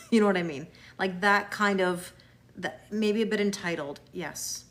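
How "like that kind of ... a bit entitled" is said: ordinary talk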